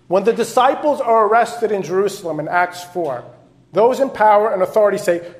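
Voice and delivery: loud storytelling sermon; emphatic pulpit retelling; forceful preaching. Acted.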